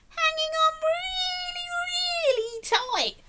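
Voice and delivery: high, drawn-out stress on really tight; emphatic really tight. Real, not acted. Reader showing the child how tight the snail holds, high and slow. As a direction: stretch the words to show the grip.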